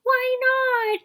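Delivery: put-on very high, loud chorus of young birds; crying out. A narrator's reading. Cygnets protest leaving the pond, crying out. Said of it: "cried the cygnets".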